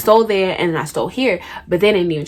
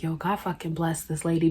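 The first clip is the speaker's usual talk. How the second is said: private relieved thought; 2 semitones lower, 13 dB quieter than usual. Retold or imitated. Teen silently thanking lady officer, hushed relief. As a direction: murmur it to yourself. In my head.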